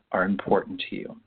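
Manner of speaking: ordinary delivery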